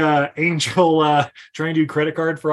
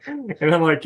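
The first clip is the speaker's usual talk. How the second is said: ordinary talk, nothing marked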